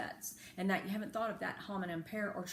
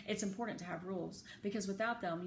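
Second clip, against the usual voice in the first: plain reading voice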